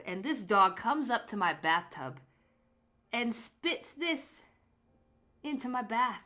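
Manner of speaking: usual speaking voice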